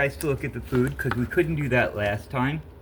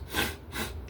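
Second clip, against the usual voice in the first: sniffing; 9 dB quieter than usual. Shown as a demonstration. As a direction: sniff hard through a stuffy nose. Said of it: "got obsessed with the fact that I was".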